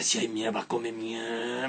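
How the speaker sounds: slow, soft low scold; angry mother. Retold or imitated. Mother scolds son in a slow, hushed growl. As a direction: growl it slowly under the breath. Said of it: "me decía así".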